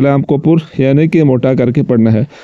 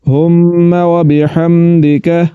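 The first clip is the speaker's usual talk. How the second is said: slow, measured recitation; 2 semitones higher, slower than usual. Shown as a demonstration. Teacher reciting the next part for students. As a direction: recite it carefully. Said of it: "recites the joined phrase".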